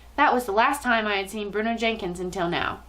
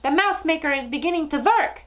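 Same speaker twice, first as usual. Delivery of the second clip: high, excited witch cry; witch's shriek; 6 semitones higher than usual. A narrator's reading. Witch watching the mouse maker work, shrieking. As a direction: crow it in triumph. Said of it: "shrieked the Grand High Witch".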